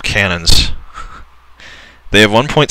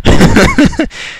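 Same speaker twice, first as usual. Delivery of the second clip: high-pitched exasperated laugh; 4 dB louder than usual. Real, not acted. Gamer laughing at the cheating AI, high laugh.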